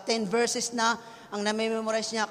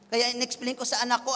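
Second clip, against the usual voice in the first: ordinary talk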